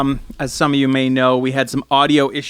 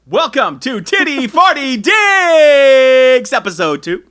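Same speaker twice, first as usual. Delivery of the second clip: podcast intro; 9 semitones higher, 6 dB louder, slower than usual. Acted. Opening the podcast episode.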